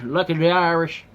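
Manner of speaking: a bad, half-hearted stab at an Irish accent; mock Irish toast, clumsy Irish brogue. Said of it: "I can't even do that accent".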